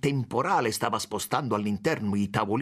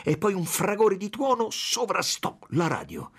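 ordinary delivery

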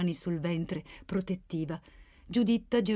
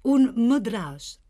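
curt retort, slow and louder; secca replica; 2 semitones higher, 6 dB louder, much slower than usual. A narrator's reading. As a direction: answer drily, each word weighed and firm. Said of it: "ribatté seccamente Pellegrino". (replica secca, lenta e decisa)

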